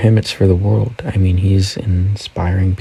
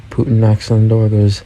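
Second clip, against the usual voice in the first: ordinary delivery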